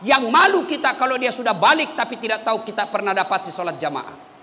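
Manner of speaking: usual speaking voice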